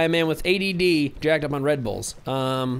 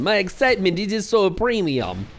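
hammed-up Eastern European accent, higher pitched; 4 semitones higher than usual. Streamer joking about his excitement in Eastern European accent. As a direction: play it as a mock Eastern European. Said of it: "talking like an Eastern European".

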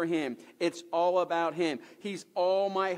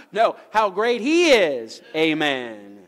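very loud, slow, raised proclamation; exultant preaching shout; 11 dB louder, slower than usual. Real, not acted. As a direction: shout the correction with drawn-out praise, landing on a loud amen. Corrects himself, exalting God to congregation.

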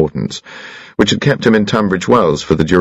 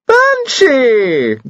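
high, delighted call of a name; delighted cry. A narrator's reading. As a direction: call out with glad surprise. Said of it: "cried Lady Carados in delight".

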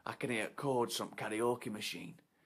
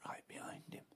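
suspense whisper; 13 dB quieter, faster than usual. A narrator's reading.

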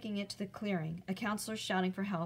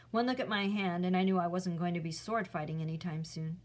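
usual speaking voice

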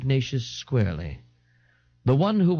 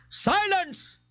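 very high-pitched bellow, held back in volume; strained, high cry of command. A narrator's reading. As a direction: cry out to silence a crowd. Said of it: "Ignatius bellowed over their enraged babbling".

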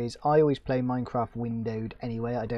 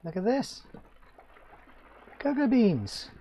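excited; 11 semitones higher, 4 dB louder than usual. Real, not acted. Spots cocoa beans in game.

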